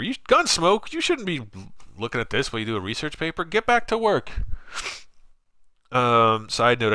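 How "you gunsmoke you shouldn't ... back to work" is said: lightly voiced high teasing scold; playful mock telling-off. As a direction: wag a finger at the viewer in fun. Teasing a chat viewer.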